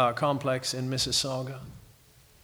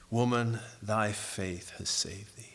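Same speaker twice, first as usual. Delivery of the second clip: soft and tender; 4 semitones lower, 6 dB quieter than usual. Retold or imitated. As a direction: speak comfort softly to the woman. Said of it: "were soft and so tender".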